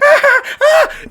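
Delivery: very high ticklish giggle; a sudden high-pitched squeal of a laugh. Real, not acted. Vlogger squealing at the thought of being tickled.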